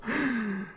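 high, drawn-out gasp; startled gasp. Real, not acted. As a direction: suck in air sharply at a shadow. Reacts to big shadow in game.

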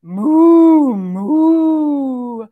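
loud long moo like a cow. Pretend. Teacher mooing like a cow for the children. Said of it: "they say".

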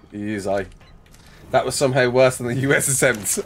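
ordinary talk, nothing marked

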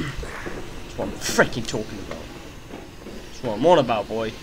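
very low, soft satisfaction; low gloating. Real, not acted. Gamer savouring the win over the boss, low gloating.

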